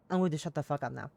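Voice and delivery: ordinary talk, nothing marked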